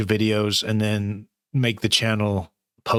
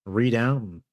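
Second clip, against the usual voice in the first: usual speaking voice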